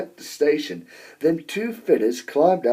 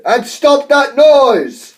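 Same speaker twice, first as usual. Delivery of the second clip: loud, high angry bellow; 10 semitones higher, 10 dB louder, slower than usual. A narrator's reading. Sir Topham Hatt ordering Gordon out, bellowing. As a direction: roar the order over the noise. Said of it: "he bellowed".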